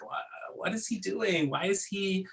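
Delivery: soft, high, puzzled voice; imitating bewildered listeners. Speaker voicing a stunned audience, lightly acted puzzled question. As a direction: ask it lightly, mock confused, under your breath. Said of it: "stunned and uncomfortable and disoriented".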